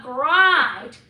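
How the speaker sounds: GRIND with stressed Scottish R; loud, stretched Scottish GRIND; Scottish dialect. Shown as a demonstration. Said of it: "much more emphasis on the R sound".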